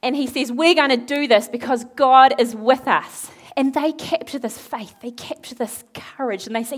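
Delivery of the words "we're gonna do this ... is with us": loud, raised declaration, acted; rally them with full conviction; rousing rallying cry. Nehemiah gathering people to rebuild, rousing loud cry.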